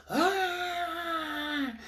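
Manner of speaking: long, high held wail; mock cry on one high note; imitated child's cry. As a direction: mimic a child wailing on and on.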